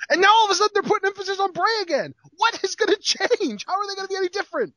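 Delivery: high-pitched, incredulous complaint; exasperated rant. Real, not acted. Host griping about Bray's push, exasperated. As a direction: voice leaping up in exasperation.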